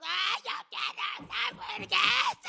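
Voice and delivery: high, slow whiny shout; spoiled child. Retold or imitated. A spoiled child shouting for a hamburger. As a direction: whine like a spoiled five-year-old.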